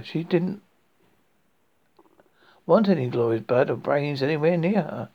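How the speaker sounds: usual speaking voice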